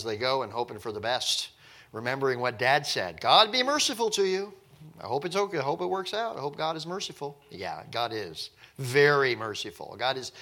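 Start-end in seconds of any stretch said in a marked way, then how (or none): 3.2-7.4 anxious hope. Retold or imitated. Brothers hoping, father's words.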